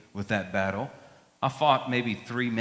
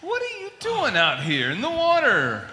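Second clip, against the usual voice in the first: surprised; 10 semitones higher than usual. Acted. Captain surprised by sailor.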